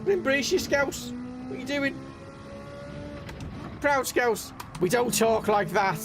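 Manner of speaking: put-on protesting to mum about her accent, high and indignant; mock indignant reply. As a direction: fire it back at mum in high disbelief. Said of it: "It's like".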